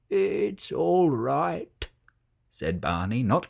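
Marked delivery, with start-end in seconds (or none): 0.1-1.9 reassuring. A narrator's reading. Barney comforts Francesca.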